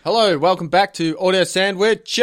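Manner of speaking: loud, slow, drawn-out intro; talking loud. Real, not acted. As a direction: boom it out to drown him out. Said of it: "trying to talk loud".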